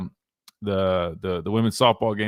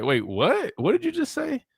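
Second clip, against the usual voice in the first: mock bafflement; 12 semitones higher than usual. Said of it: "you're just like".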